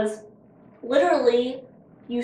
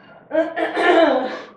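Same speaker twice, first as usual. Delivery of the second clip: throat clearing; 6 semitones higher, 9 dB louder than usual. Real, not acted. Pecan stuck in throat.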